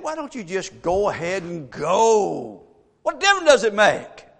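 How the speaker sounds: mock the king with a shrug in the voice; holy sarcasm. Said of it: "a little holy sarcasm".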